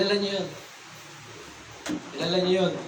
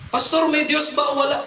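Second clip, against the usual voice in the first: higher-pitched shouted question, held back in volume; retold yell, pitched up without full force; mock shouting drunk; 7 semitones higher than usual. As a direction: imitate a drunk man calling out a challenge. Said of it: "Pasigaw, ah".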